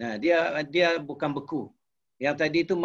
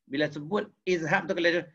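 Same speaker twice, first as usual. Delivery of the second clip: ordinary talk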